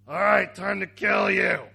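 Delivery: loud, slow mock threat; mock menacing shout. Brother threatens the one who bowed, mock menacing shout. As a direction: bellow it slowly like a killer.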